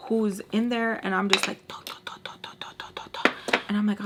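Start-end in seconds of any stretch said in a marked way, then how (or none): 1.7-3.6 breathy whispered toc toc knocks; whispered knocking. Retold or imitated. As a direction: mimic knocking on glass in a whisper. Imitating knocks on window.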